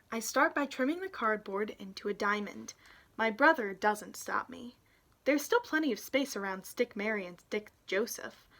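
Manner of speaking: usual speaking voice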